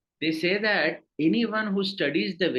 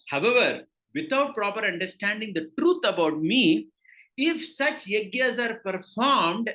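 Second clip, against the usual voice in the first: raised, deliberate reading of the translation; reading aloud; 4 semitones higher than usual. Acted. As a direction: read the scripture out to the class. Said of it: "I'll read the translation first".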